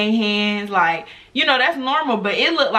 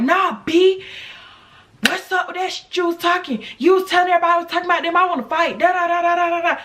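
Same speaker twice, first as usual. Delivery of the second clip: mock angry rant; 8 semitones higher, faster than usual. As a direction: mimic the bully mouthing off, pitched way up. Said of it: "she's just going off".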